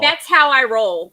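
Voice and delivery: slow, loud imitated boast; loud mocking quote. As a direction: belt out the mocked boast slowly. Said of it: "She says".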